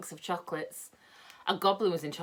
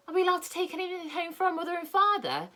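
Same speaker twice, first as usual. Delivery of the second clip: much higher, hopeful question; a boy asking politely; child asking; 11 semitones higher than usual. Acted. Joe asks Moonface.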